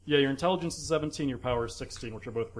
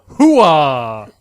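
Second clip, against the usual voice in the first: big soldier war cry, very loud; loud gung-ho soldier; 16 dB louder than usual. Pretend. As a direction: bellow it like a hyped-up marine. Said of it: "I yell".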